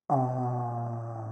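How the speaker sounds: long low sighing ah out; relaxed exhale ah; sighed ah. Shown as a demonstration. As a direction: let the breath out on a low ah. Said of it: "side out with a ah".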